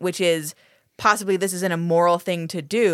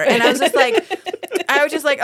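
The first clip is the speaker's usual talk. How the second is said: ordinary talk, nothing marked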